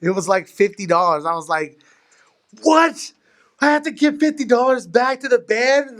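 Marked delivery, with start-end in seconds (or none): 2.6-6.0 high, incredulous outburst at a bill; mock outrage. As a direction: jump up high in disbelief. Retold shock at pillow bill.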